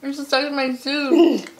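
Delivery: chewing through sticky toffee; talking while chewing candy; mouth full. Real, not acted.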